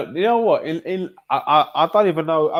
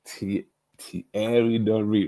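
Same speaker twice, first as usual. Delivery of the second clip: usual speaking voice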